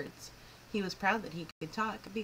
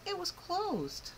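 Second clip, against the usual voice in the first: slow, high letdown; dismay; 11 semitones higher, much slower than usual. A narrator's reading. Narrator revealing the school was closed, slow high dismay. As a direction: stretch it out as a sad surprise. Surprise, school shut on Saturday.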